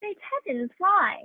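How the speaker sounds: loud startled why; shocked and loud; astonished outburst. Acted. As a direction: blurt out disbelief. Shock at the postman news.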